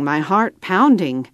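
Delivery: usual speaking voice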